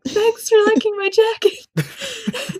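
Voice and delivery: high, slow, dreamy mock anime teen. Quoting a dream friend's thanks in a high slow anime voice. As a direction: squeak it out like a tiny anime boy. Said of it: "He was a tiny anime teenager".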